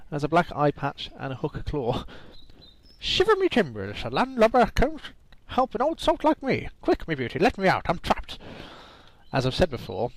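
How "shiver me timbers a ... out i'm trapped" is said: high, hammed-up pirate accent, much higher. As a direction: ham up a bad pirate accent, pitched way up. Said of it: "I can't do pirate accents".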